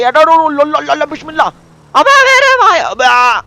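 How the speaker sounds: high, loud whooping; excited shouting. Real, not acted. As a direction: yell with thrill on the ride. Thrilled shouting during drift. (रोमांच में ज़ोर से चिल्लाना)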